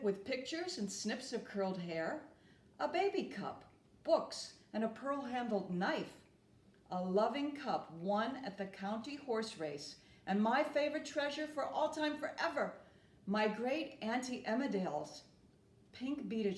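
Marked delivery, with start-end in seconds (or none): none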